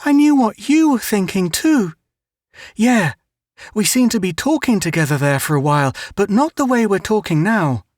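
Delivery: put-on high, earnest little girl; Mary Ann talking to her brother Melvin, high girlish voice; young girl voice. A narrator's reading. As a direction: voice the sister, bright and eager.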